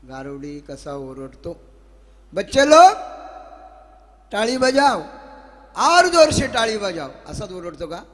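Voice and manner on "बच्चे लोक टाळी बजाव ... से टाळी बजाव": loud, high street-performer call; snake charmer's yell. Acted. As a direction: holler to draw applause from a crowd. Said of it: "कसा गारुडी ओरडतो?". (गारुड्याची जोरदार, चढ्या आवाजातली आरोळी)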